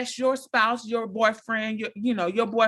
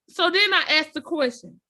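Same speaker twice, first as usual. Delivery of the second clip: usual speaking voice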